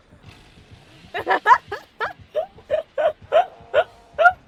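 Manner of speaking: high, loud cackling laughter; real laughing fit. Real, not acted. Gamer cracking up at a killer's funny humps, high loud laughing fit. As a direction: burst out laughing, long and high.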